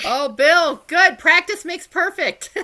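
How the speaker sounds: loud, high cheer; excited. Real, not acted. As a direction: cheer him on with delight. Cheering a returning player.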